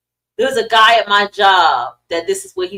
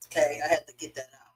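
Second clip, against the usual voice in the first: deep dry voice; 7 semitones lower, 9 dB quieter, much faster than usual. Real, not acted. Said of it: "Why my voice got so deep?".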